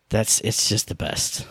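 slow, hushed, almost unvoiced; breathy whisper. Real, not acted. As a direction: let it melt out like a contented sigh. Blissful sigh after praising game.

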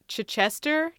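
Chichester as "chuh-CHESS-ter", questioning; short first vowel in Chichester, rising; trying pronunciations. Shown as a demonstration. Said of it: "Is it".

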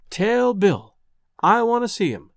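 "Tell Bill, I want to see him" in two measured, drawn-out beats; rhythmic echo. A narrator's reading. Man hearing her words as he walks, "Tell Bill, I want to see him" as a rhythmic echo. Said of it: "I heard them over and over again".

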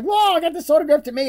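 high, loud, delighted exclamation; mock thrilled fan. Imagined buyer finding an autograph in his name, mock thrill. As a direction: gush like an imagined lucky collector.

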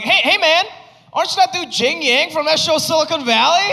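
high-pitched excited fan voice; eager fan. Retold or imitated. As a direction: squeal it like a starstruck stranger. Fans asking if he's the actor.

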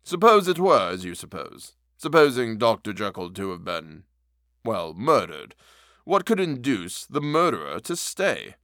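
lightly voiced measured, doubtful gentleman; a hint of a reserved lawyer; uneasy lawyer. A narrator's reading. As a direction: weigh the words, gently sceptical. Utterson voiced in story.

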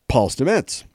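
Stamets said as stuh-METS, stress on the second syllable; correct pronunciation. Shown as a demonstration. Host fixing a name just misread: Stamets as stuh-METS. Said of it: "And that's how you pronounce his name".

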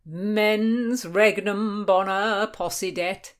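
stately, stretched-out quotation; quoting Latin. A narrator's reading. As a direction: intone the Latin maxim grandly. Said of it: "Sir Henry Lee quoted".

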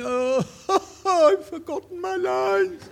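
mock-despair dissolving into laughter; laughing quote. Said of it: "he kept saying".

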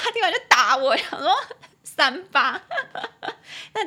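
loud, laughing retelling. Real, not acted. Telling how the friend hit back, laughing loudly. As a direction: burst out laughing while talking.

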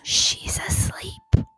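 slow soft whisper; hush, the puppy is asleep. Real, not acted.

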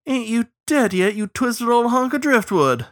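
high, teasing crow voice; joshing crow. Acted. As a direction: trade a fond insult in a high voice. Crow trades jibes with friend.

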